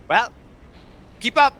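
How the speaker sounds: calling out. Acted. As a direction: call back briskly for them to keep up. Character calls to party to follow.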